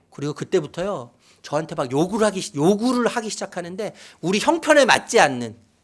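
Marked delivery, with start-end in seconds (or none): none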